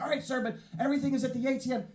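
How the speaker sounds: high put-on clerk voice; rookie teller. New bank teller explaining to a customer, high and officious. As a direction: recite the rules in a high, officious pitch.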